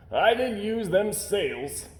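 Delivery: a sailor mildly puzzled; slow old sailor. A narrator's reading. Sailor voiced.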